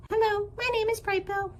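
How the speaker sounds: high, chirpy small-bird greeting; gosling introduces himself to anyone, high and chirpy; little gosling voice. Acted. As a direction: greet everyone like a tiny gosling. Gosling voice in story.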